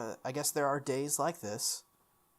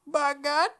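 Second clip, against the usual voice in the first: high, loud mock grumble of an old-timer; hammed-up old man; 14 semitones higher, 7 dB louder than usual. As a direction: ham up a cranky old-timer bit. Mock old-man repeat of line.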